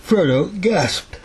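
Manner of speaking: words stretched through a yawn. Real, not acted. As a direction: read it mid-yawn, drowsy. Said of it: "I'm yawning".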